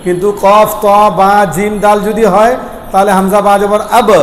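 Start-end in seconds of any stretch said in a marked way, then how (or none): 4.0-4.1 আব with the b struck and released in a tiny echo vowel; bouncing final b. Shown as a demonstration. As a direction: bounce off the b at the end of আব. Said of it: "একটা টক্কর খাবে".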